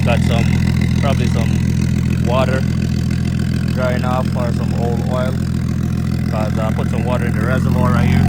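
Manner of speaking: talking loudly over a revving engine. Real, not acted. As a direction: raise your voice over engine noise.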